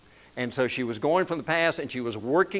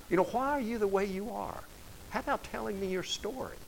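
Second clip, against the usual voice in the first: gentle asking voice; 5 semitones higher, 9 dB quieter than usual. Retold or imitated. World voiced asking about us.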